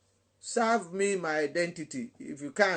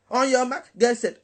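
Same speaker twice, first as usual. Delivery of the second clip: loud, raised race-start call; race starter call; 5 semitones higher, 8 dB louder than usual. Retold or imitated. Preacher mimicking songs that rush people, loud starter call.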